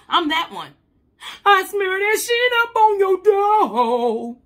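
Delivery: high and loud, slow, half sung bragging; loud boasting. Acted. Telling the listener she is the vengeful one, loud and sung. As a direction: belt it out like a petty anthem. Brags about petty revenge, loud.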